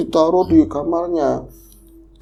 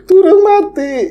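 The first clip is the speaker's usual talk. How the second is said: put-on very high, loud, slow old voice; indignant grandmother; 8 dB louder, slower than usual. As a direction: protest as the old woman. Menirukan nenek menyanggah. (nenek memprotes dengan suara sangat tinggi)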